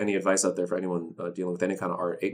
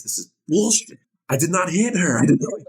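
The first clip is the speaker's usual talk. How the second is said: higher and louder, venting a bitter complaint; frustrated outburst; 9 semitones higher, 8 dB louder than usual. Retold or imitated. Teen actor ranting about his small role, loud and indignant. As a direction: let the indignation burst out loud. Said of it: "I'm like".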